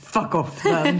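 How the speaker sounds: fake-annoyed, laughing; mock snippy. Fake-snapping at a friend over texts, laughing mock annoyance. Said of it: "jag bli så här".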